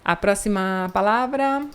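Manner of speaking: usual speaking voice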